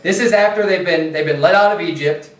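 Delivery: usual speaking voice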